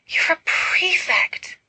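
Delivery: high, slow, breathy adoring whisper; breathed. Acted. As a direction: breathe it out in awe. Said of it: "Carmina breathes".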